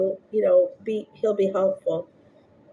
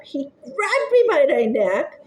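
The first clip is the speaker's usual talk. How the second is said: usual speaking voice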